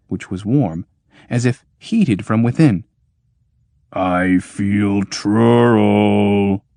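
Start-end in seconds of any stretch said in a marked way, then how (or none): 3.9-6.6 very slow booming machine voice; booming iron voice. Acted. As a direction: drag each word out like a giant machine. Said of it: "boomed the iron voice".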